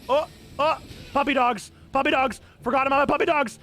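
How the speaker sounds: ordinary talk, nothing marked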